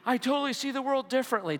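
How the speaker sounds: high, fast, starry-eyed; mock inspired gush. Preacher mocking his own fleeting inspiration. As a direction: gush like you've just been converted.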